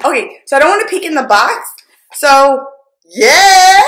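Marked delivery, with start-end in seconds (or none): 3.1-3.8 high, loud, gleeful yay; excited cheer. Real, not acted. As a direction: cheer on opening a gift. Finds the wanted gift in box.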